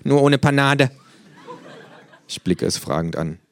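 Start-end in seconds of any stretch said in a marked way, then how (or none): 0.0-0.9 higher, louder kangaroo with a laugh. Acted. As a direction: boast of war stories, pitched up and loud. Kangaroo character voice.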